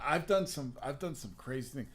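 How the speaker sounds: ordinary talk, nothing marked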